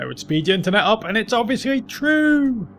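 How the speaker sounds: sarcastic sing-song, rising high; mock sarcasm. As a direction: say it with obvious fake conviction. Mock claim about noobs.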